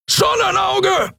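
high, slow, drawn-out yell of Sonnenauge; furious shout. Acted. Keru warning the crew of the weapon, a high drawn-out roar. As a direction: bellow the warning across the bridge. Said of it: "brüllte Keru".

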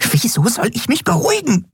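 high, tiny fairy voice, upset; a little winged friend scolding in alarm; agitated fairy. A narrator's reading. (aufgeregte kleine Fee, hoch)

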